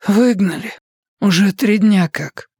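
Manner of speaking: put-on a light gloomy young woman; gloomy answer. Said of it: "мрачно ответила она".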